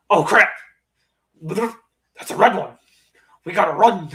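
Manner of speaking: a slow startled monster; mock Venom spotting danger; imitating Venom. Venom voiced, retelling movie.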